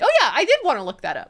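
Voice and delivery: light burst of remembered interest; pleasantly reminded. Acted. Friend reminded of a recommended book, pleasantly reminded.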